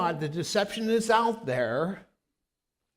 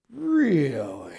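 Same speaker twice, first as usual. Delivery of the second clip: drawn-out, louder mock disbelief; incredulous; 3 semitones lower, 4 dB louder than usual. Preacher replaying his reply to a debater, incredulous. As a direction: stretch it out in exaggerated doubt.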